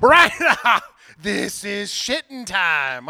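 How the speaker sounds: loud, high opener into slow, drawn-out bad impression; hammed-up movie-hero impression, badly faked; Gerard Butler. Host failing at a celebrity impression, badly hammed-up. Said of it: "I wanna do a Gerard Butler impression".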